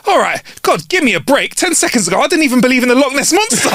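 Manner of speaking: high-pitched flustered pleading; acted indignant excuse; exasperated protest. Flustered atheist argues back to God.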